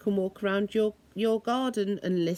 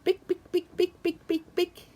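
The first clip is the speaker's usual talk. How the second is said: higher rapid big big big; running heels; 9 semitones higher than usual. Retold or imitated. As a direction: patter it fast like heels running. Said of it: "the lady starts to run".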